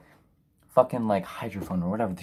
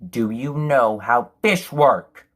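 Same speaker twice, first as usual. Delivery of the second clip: slow, louder, condescending question; exasperated rant; 7 dB louder, much slower than usual. Real, not acted. Reviewer lectures the writer, slow and louder. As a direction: lean on every word, fed up.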